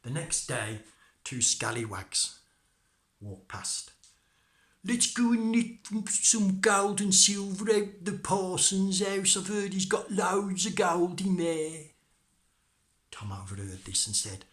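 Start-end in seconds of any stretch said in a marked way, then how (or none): 4.8-11.9 loud, high, drawn-out robber voice; scheming scallywag. Acted. Scallywag to partner, plotting to rob the parson, high and loud. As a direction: play a scallywag pitching a heist, high and loud.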